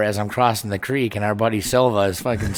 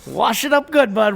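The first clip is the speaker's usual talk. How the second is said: mock encouragement; 12 semitones higher, 4 dB louder than usual. Mock advice to friend.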